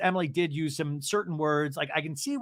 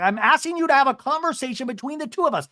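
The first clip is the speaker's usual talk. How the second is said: high, loud, heated insistence; heated person voice; 9 semitones higher, 5 dB louder than usual. Retold or imitated. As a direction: imitate someone getting heated mid-argument. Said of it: "She's using heated person voice".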